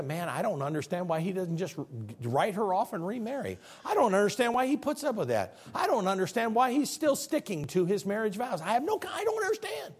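gossip's bewilderment rising to a high finish; baffled townsperson. Acted. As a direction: act out an exasperated neighbour. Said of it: "you don't think people talk like that back then".

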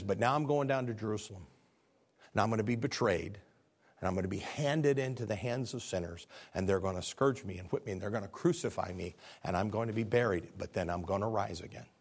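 low, subdued, quiet voice; solemn foretelling. Acted. Jesus tells disciples of his coming death, solemn and hushed. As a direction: say it softly and low, phrase by phrase.

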